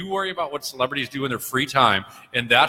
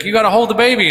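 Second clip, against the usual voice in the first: higher-pitched mock pleading; eager fan impression, voice raised in pitch; pushy fan; 10 semitones higher, 5 dB louder, faster than usual. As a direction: imitate an insistent fan, pitched up. Retells a pushy fan's plea.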